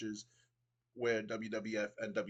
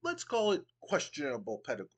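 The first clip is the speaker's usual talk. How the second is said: a hint of a haughty British commissioner, pitched higher; pompous Regal; 6 semitones higher than usual. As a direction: sneer down at the crowd with mock refinement. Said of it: "Regal goes".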